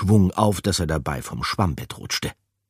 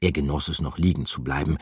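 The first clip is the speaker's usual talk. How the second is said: ordinary delivery, nothing marked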